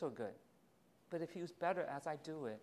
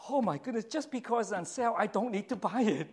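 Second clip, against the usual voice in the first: mock self-scolding; 5 semitones higher, 8 dB louder than usual. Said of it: "I go".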